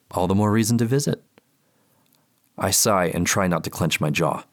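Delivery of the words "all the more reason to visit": light, higher professional voice; polite clinical therapist. Acted. Therapist on the phone.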